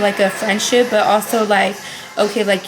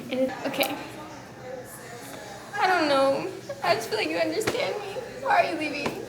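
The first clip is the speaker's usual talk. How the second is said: tearful goodbye; 3 semitones higher, 8 dB quieter than usual. Real, not acted. Crying farewell speech.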